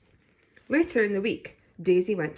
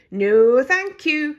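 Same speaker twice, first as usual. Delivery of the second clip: breezy loud refusal; 7 semitones higher, 12 dB louder, much slower than usual. A narrator's reading. Daisy refusing while running.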